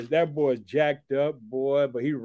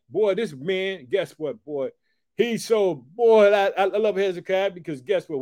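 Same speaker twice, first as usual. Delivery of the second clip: ordinary talk, nothing marked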